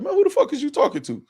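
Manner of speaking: high-pitched indignant comeback; mock outraged retort. Imagining replying to Jay Mills, high indignant retort. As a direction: act out snapping back at the insult.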